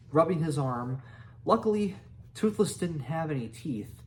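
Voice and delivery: usual speaking voice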